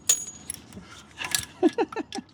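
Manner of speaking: real burst of laughter; laughing. Real, not acted. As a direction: laugh it out, high and breaking. Laughs while fitting bolts.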